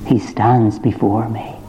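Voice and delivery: normal talking voice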